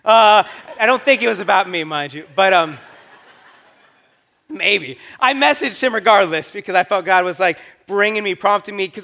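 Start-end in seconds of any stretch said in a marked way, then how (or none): 0.1-5.0 laughing. Real, not acted. Laughs at himself.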